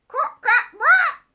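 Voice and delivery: high, loud squawky quack; bird quacks. Acted. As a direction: squawk it like a duck. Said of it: "if you hear this".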